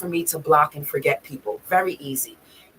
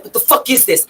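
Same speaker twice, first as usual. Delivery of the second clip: higher-pitched retold outrage; mock indignation, pitched up; enraged quote; 7 semitones higher, 4 dB louder than usual. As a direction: imitate his angry disbelief. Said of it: "he was enraged".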